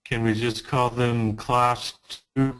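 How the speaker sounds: ordinary talk, nothing marked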